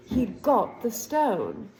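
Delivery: ordinary delivery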